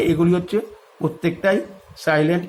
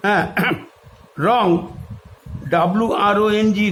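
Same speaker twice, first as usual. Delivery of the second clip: ordinary delivery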